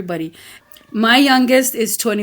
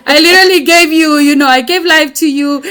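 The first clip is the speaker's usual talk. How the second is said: louder, higher, half laughing protest; laughing indignation; 4 semitones higher, 6 dB louder than usual. Retold or imitated. Mother protesting to the military, louder, laughing and emotional. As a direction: protest through laughter and tears.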